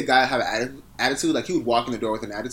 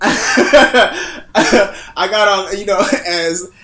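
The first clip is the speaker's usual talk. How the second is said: words stretched through laughter, louder; can't stop laughing; 6 semitones higher, 10 dB louder, much slower than usual. Real, not acted. Laughing fit mid-story.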